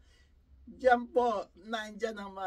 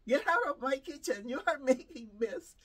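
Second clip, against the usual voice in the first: higher, louder mock telling-off; imitating a scolding client; 4 semitones higher, 5 dB louder than usual. Elderly client to caregiver in the kitchen, imitated scolding. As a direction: scold like a cranky client, playfully exaggerated. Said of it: "Ganun lang po sila".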